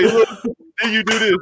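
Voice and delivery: normal talking voice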